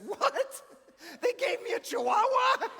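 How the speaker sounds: shrieking high, loud mock outrage; fake shock. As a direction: scream it like you just found out. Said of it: "I said".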